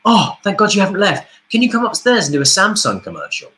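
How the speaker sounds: high, urgent relief; breathless excitement. Retold or imitated. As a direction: she ran out after him, so sound relieved and in a hurry. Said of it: "she came rushing out of the fire exit".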